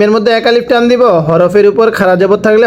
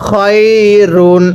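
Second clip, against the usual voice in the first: খয়রুন stretched very slowly; slow model reading of খয়রুন; slow drawn-out reading; much slower than usual. Shown as a demonstration. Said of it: "আবারও পড়বেন".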